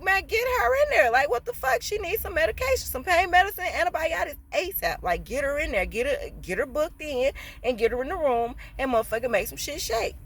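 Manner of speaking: high, loud, impatient orders to staff; urgent commanding order. Retold or imitated. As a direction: bark orders to get her admitted now. Supervisor ordering staff, retold.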